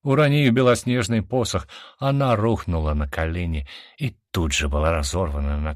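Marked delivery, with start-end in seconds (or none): none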